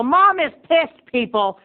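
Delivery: high, loud, slow outburst; hammed-up indignant yell; mock outrage. Host bursting out to his viewers, loud and high. Snack show host ranting about mom.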